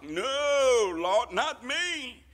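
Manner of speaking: high, drawn-out denial; acted-out disciple insisting it can't be him; pleading protest. Preacher acts out Peter's protest.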